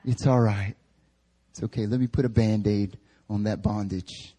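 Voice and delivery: put-on hushed, babying comfort; coddling reassurance.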